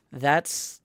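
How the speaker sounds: ordinary talk, nothing marked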